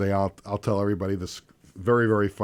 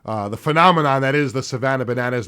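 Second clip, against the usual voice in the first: radio announcer; 8 dB louder than usual. Acted. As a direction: welcome listeners back like a big radio host. Radio host opening segment.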